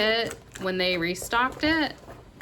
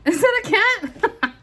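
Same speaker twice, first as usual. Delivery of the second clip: high, louder, laughing disbelief; laughing question; 11 semitones higher, 5 dB louder than usual. Real, not acted. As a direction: ask it through a burst of laughter. Laughing at her own question.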